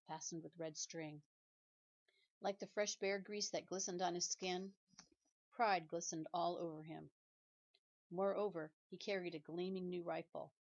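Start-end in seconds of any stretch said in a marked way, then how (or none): none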